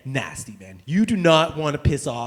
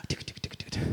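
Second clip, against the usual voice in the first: soft unvoiced tick tick of typing; whispered ticking; 13 dB quieter than usual. Miming keyboard clicks for the audience in a whisper.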